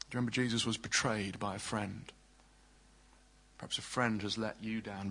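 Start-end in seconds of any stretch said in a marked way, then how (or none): none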